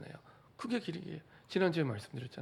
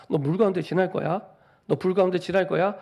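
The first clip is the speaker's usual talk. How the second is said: God's voice warning of fire and water, proclaimed louder; louder proclamation; 4 semitones higher, 6 dB louder than usual. Acted. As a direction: declare it louder, as God announcing. God's words repeated louder.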